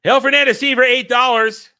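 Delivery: loud, high donation shout-out. Real, not acted. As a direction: hype up the supporter's name. Reading out a chat donation.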